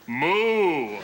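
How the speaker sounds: long imitated moo; cow moo. Tourist ordering milk in sign language, drawn-out cow moo. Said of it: "ordering milk and going".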